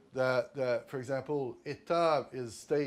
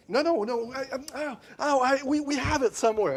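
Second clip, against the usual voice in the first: high, loud, flustered protest; flustered clerk comedy; 12 semitones higher, 10 dB louder than usual. Retold or imitated. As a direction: ham up a panicked clerk saving face. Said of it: "he has to play a comedy".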